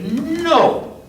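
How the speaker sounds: high, firm no. Acted. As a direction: declare no bravely. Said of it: "stand up and say".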